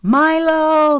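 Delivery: long, high, loud call. A narrator's reading. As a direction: call the name across the storm. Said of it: "they called simultaneously".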